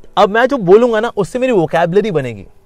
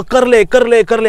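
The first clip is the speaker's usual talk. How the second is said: louder, pushy urging; urging mind; 5 semitones higher, 6 dB louder, slower than usual. Acted. Restless mind urging the self to act, pushy. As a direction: egg yourself on.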